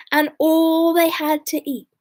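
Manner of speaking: plain reading voice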